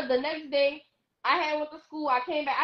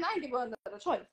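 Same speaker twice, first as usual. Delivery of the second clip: ordinary talk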